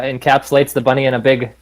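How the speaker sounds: ordinary delivery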